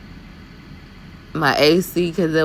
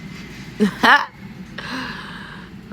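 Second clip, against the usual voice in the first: breathy laugh; 9 semitones higher than usual. Real, not acted. Laughing at her own line.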